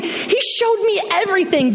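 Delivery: ordinary delivery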